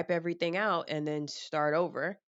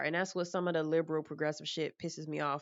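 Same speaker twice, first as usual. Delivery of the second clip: ordinary talk, nothing marked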